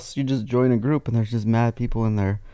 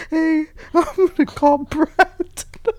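mock girly voice; slower than usual. Mocking friend calling boys.

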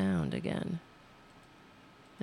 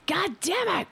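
high, sharp cursing; pitched-up angry god damn it; frustrated outburst; 11 semitones higher than usual. Real, not acted. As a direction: swear at the screwup. Frustrated curse repeated.

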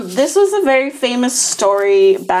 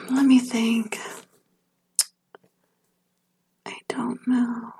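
breathy whispered musing, drawn out slowly; 2 semitones higher, 7 dB quieter, slower than usual. Real, not acted. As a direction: whisper it as if thinking aloud. Pondering the ticket question.

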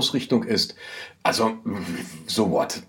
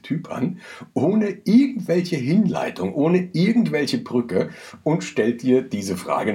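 indignant; 7 semitones higher, slower than usual. Real, not acted. Empörte Betonung.

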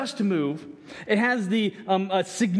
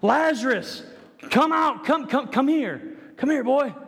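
high, raised come come come here; summoning call; 6 semitones higher than usual. Acted. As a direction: summon him, coaxing. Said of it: "Come to me".

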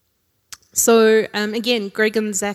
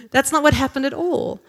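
higher, louder, still amused; amused denial; 5 semitones higher, 5 dB louder than usual. Real, not acted. Preacher amused corrects her joke for the congregation. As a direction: shake it off with a grin.

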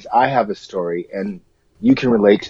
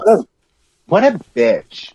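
higher, slow sassy put-on voice; mock diva voice; 9 semitones higher than usual. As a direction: sneer it out like a diva.

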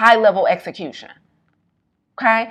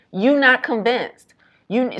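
ordinary talk

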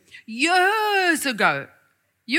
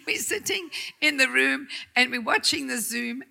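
ordinary delivery

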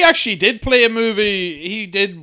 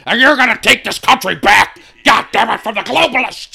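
higher, louder rallying cry, lightly voiced; mock patriot rant; 5 semitones higher, 3 dB louder than usual. Mocking a gun-toting man, ranting lightly about taking the country back. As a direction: rouse the crowd like a hammed-up demagogue.

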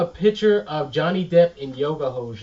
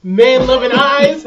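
laughing; 8 semitones higher, 10 dB louder, slower than usual. Real, not acted. Laughing Depp reveal.

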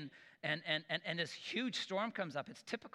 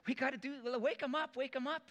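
high, rushed mock panic; a hint of frantic fishermen waking their master; frantic disciples; 9 semitones higher than usual. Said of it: "they say".

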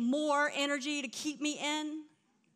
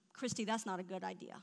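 lower, softer, quick calm correction; gentle Holy Spirit voice; 6 semitones lower, 10 dB quieter, faster than usual. Retold or imitated. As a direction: talk her down gently. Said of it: "And the Holy Spirit's like".

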